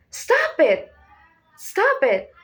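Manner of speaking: urgent cry that rises and falls; Eleven. Acted. Teacher imitating Stranger Things main character's shout, mildly urgent. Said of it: "the main character shouts".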